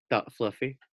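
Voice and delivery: usual speaking voice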